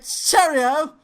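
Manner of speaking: high, loud hammed-up British voice; mock British accent. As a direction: put on a silly British accent through laughter. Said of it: "gotta do the Brit accent".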